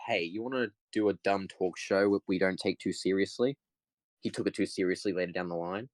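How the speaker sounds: ordinary delivery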